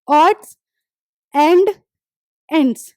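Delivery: "odds... and... ends", each word standing alone; long pauses between ODDS, AND, ENDS; demonstrating D sound. Shown as a demonstration. Teacher points out the shared D sound, spaced-out demonstration. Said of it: "ड की sound आ रही है सब में".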